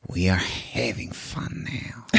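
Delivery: low menacing whisper, slow. Acted. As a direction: hush it like a threat. Lambert gets serious after gunshot.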